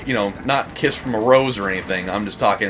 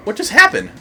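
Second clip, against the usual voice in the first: sudden high, louder exclaim; jolted by something in the race; surprised; 5 dB louder than usual. Real, not acted. Gamer caught off guard by the race, surprised.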